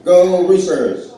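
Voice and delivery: mock cheer. Cheering the local team.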